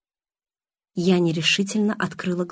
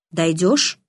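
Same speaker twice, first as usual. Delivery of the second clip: usual speaking voice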